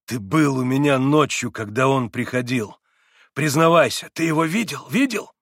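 urgent demand. Acted. Иван seizes Алёша, demands.

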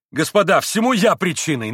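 loud, high, earnest outburst; eager confession. A narrator's reading. Mitya addresses the company again, loudly contrite. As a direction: take the blame out loud. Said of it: "начал опять Митя".

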